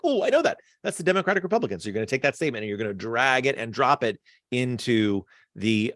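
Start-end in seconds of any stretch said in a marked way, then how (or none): 0.0-1.8 eager realization. Pretend. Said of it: "you're gonna be like".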